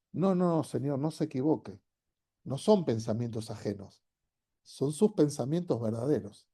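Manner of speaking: soft, gentle correcting voice; hushed reassurance, setting him straight; quiet correction. Retold or imitated.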